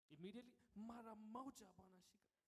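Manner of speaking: street hawker. Acted. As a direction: hawk it like a street seller. Hawker pitch again.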